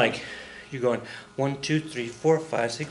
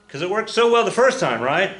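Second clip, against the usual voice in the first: sarcastic; 6 semitones higher, 4 dB louder than usual. Real, not acted. Sarcastic aside.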